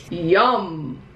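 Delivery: low, satisfied yum. Acted. Character eats.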